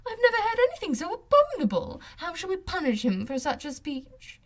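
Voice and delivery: Miss Bingley. A narrator's reading. Miss Bingley scandalised at Darcy, playfully indignant.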